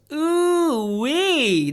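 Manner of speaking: high, loud mock-disgusted ooh-wee; sudden high yelp of disgust; ooh-wee exclamation. Real, not acted. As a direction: react like you smelled something bad. Reacting to bad financials.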